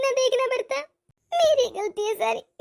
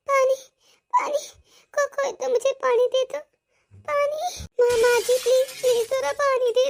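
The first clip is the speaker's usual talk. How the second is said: weak parched plea; 3 semitones higher than usual. Acted. Starving locked-up bride calling for water, weak and parched.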